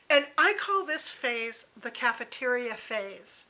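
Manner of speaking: ordinary talk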